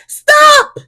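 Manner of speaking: very high, loud yell; shouting. A narrator's reading. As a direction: bark an urgent stop. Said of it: "shouts Sticky".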